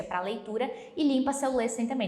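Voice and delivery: ordinary talk, nothing marked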